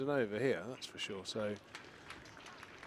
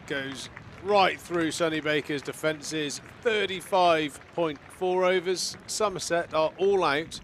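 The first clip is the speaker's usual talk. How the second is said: louder, higher, slowed for the big moment; excited wicket call; 5 semitones higher, 8 dB louder, slower than usual. Real, not acted. Wicket falls, innings ends.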